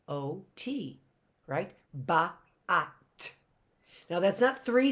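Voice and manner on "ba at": "Ba-at" broken into buh, ah, t; sounded out. Shown as a demonstration. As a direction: separate "Ba-at" into three sounds with gaps. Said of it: "That was three sounds".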